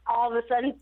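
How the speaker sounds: normal talking voice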